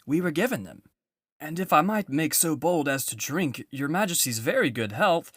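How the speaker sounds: put-on an old fox trying bravely to please; nervous old fox. A narrator's reading. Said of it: "said the fox".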